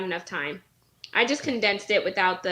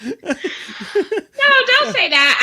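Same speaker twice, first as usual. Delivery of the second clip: laughing protest; 11 semitones higher, 7 dB louder, much slower than usual. Real, not acted.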